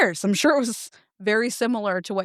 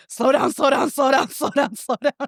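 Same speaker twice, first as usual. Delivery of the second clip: mock shout; 4 semitones higher than usual. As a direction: wave him in and yell it. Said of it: "like, waving".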